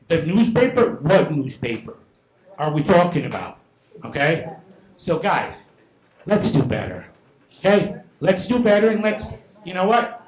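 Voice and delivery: loud, heated scolding; angry. Real, not acted. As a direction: shout down the council with frustration. Angry resident scolding the council.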